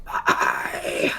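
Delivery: very low, rough "Bye" as if throat full of dust. Acted. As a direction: choke it out like a throat caked with dust. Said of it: "pretend I had dust in my throat".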